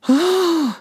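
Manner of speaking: big high gasp of shock; sudden high gasp of disbelief; sharp gasp. Retold or imitated. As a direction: gasp as if the news stuns you. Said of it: "I went".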